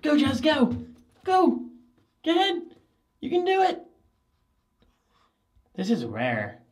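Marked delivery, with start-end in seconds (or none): 0.0-3.8 very high excited encouragement; cheering the dog. Real, not acted. Cheering dog Jazz on, very high and excited. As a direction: cheer her through the door.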